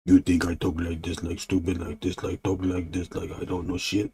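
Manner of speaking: low-key dumb monster talk, quiet; lightly voiced dim-witted monster; a hint of a dopey monster; stupid monster. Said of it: "that monster talks stupid, like, stupid talk".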